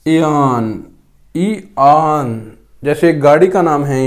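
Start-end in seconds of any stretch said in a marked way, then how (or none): 0.1-2.5 इयान said slowly, ई then आन; slow correct pronunciation. Shown as a demonstration. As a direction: stretch ईआन slowly. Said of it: "वो इयान है". (धीरे से इयान का सही उच्चारण)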